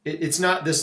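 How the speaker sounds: ordinary delivery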